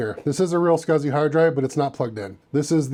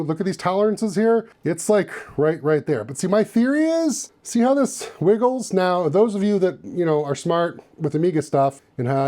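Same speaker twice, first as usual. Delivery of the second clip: ordinary delivery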